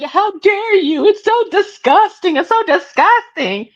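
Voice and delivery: high-pitched, drawn-out pearl-clutching; hammed-up indignant disgust; mock outrage. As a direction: act scandalized, voice way up. Acting out his outrage.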